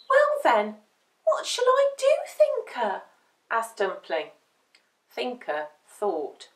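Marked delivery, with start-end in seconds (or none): 0.1-3.0 high, plaintive little-dog voice; young pup seeking advice; eager puppy. A narrator's reading. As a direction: ask a friend for help, high and loud. Dumpling asks for advice.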